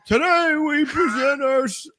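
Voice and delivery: high, slow, pompous speech voice; a lofty mock orator, drawn out; mock president speech. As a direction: ham up a president's address. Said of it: "the president's standing up there".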